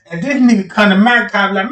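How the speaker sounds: normal talking voice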